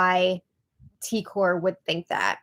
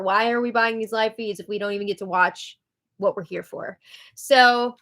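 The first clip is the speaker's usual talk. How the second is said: ordinary talk, nothing marked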